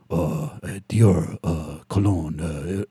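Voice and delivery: low, soft, sultry ad voice; mock Johnny Depp narration. Mocking Johnny Depp's Dior ad narration, low and soft. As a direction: purr it like a cologne ad. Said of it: "then he narrates".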